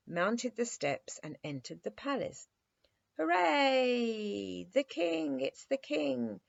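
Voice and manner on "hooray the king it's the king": high, excited cheer, slowing at the end; cheering shout. A narrator's reading. Wooden soldiers greeting their king with a high cheer. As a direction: cheer like a whole toy regiment. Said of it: "shouted the whole regiment".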